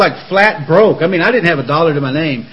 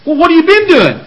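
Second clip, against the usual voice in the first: mock loud challenge; 11 semitones higher, 6 dB louder than usual. Mock dialogue with brother.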